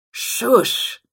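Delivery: grumbling shush; grumbled shush. A narrator's reading. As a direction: grumble it out as a sharp hush. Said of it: "he grumbled".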